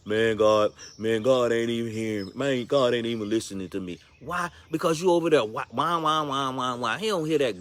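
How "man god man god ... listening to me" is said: lower, sulky grumble at God; grumbling complaint. Retold or imitated. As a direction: mope and grumble, a touch lower. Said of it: "how many times y'all done said".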